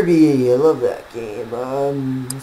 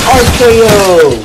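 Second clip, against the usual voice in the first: wrestling call; 8 semitones higher, 9 dB louder, slower than usual. Pretend. Calling a wrestling move.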